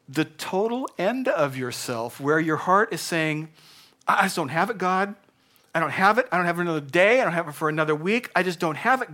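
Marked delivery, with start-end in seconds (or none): 4.0-9.1 higher, louder pleading of someone at the end of their strength; desperate prayer. Acted. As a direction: cry out to God that you can't go on. Heart crying out to God.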